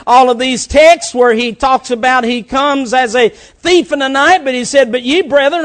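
loud preacher's climb to a high shout; fiery preaching. Acted. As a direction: preach the light to the church. Preaching at full force.

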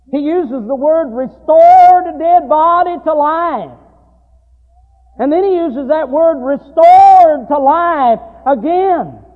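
loud, high preaching cadence; preaching at full force. Acted. Preacher retelling the story to his congregation, loud and fervent.

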